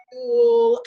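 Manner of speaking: usual speaking voice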